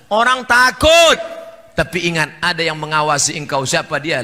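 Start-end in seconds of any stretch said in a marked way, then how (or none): 0.1-1.5 high, drawn-out shout; loud exclamation. Real, not acted. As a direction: cry it out slowly at full voice. Preacher exclaims after acted threats. (seruan lantang dipanjangkan)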